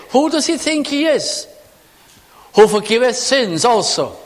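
high, indignant mocking of the critics; scoffing Pharisees. Preacher voicing the scornful onlookers, high and indignant. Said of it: "And all they can say".

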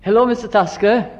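put-on high bright child's greeting; mischievous boy greeting the vague elder; kids calling sweetly. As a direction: sing out a cheeky hello to the old man. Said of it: "you'd stand by his way accidentally".

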